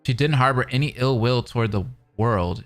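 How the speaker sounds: ordinary delivery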